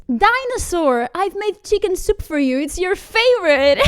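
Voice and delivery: put-on high, loud cutesy girlfriend voice; doting girlfriend. Voices girlfriend calling partner to dinner.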